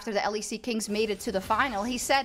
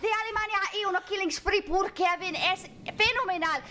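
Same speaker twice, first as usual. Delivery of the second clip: high, fast hype commentary; excited Spanish caster; 9 semitones higher than usual. Acted. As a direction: cast it like a Spanish sports announcer. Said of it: "the only thing I think I could maybe still do is Spanish".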